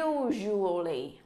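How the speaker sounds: "Usually" slow with weight on the first u; stress on u. Shown as a demonstration. Teacher asks the class to repeat "Usually", stressing u. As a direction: lean on the u of "Usually". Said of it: "chúng ta nhấn cái chỗ chữ u giùm cô".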